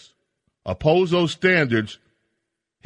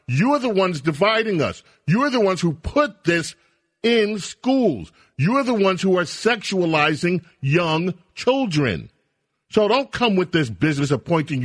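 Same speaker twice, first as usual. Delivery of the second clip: heated rebuttal; 6 semitones higher than usual. Real, not acted. Host rebuts governor heatedly.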